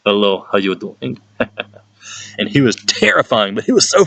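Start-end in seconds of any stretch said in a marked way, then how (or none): 0.0-1.2 put-on lightly accented, grinning hello; mild mock Russian greeting; Russian teacher greeting. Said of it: "and be like".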